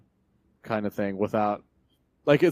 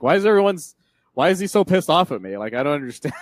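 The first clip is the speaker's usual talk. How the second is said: mildly indignant confusion, raised and louder; confused; 5 semitones higher, 4 dB louder, faster than usual. Retold or imitated. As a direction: act out a baffled dad. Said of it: "Jonathan's confused".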